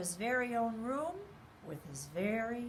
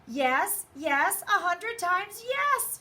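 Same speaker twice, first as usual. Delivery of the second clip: high, loud, slow delighted cry; high eager skunk shouting yes; overjoyed shout; 9 semitones higher, 7 dB louder, slower than usual. A narrator's reading. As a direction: shout it out, thrilled to have a guest. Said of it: "shouted Skunk".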